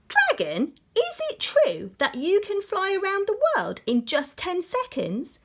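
high, loud eager princess. Acted. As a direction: egg him on, high and eager. Princess challenges dragon.

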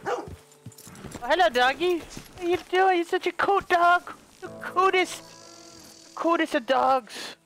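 high doting pet voice for a dog; high sing-song voice cooing at a cute dog. Real, not acted. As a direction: coo over the dog in a high voice. Baby talk to a dog.

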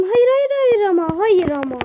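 loud, high cry of real frustration; no no no at the screen; frustrated outcry. Real, not acted. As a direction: protest it, exasperated. Opponent kills him mid-game.

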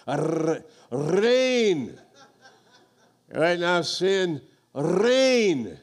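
loud, high Arrrr then reign with a rolled R; rolled r, emphatic. Shown as a demonstration. Preacher proclaiming reign with a rolled Finnish R. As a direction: roll it out big and slow, laughing. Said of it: "do that like a motorboat".

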